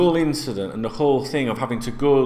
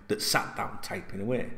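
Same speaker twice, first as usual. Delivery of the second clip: ordinary talk, nothing marked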